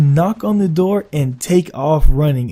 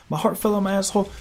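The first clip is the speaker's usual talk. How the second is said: usual speaking voice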